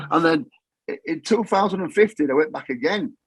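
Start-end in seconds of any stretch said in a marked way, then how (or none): none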